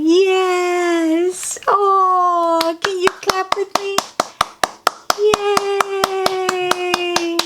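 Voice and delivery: high delighted cheering; excited cheer. Real, not acted. Reader inviting children to clap along.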